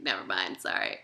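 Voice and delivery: low, meek mock apology; bashful backtracking. Streamer mimics herself blushing after getting cocky, bashful. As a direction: take it back bashfully, voice dropped. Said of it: "be blushing all the time and be like".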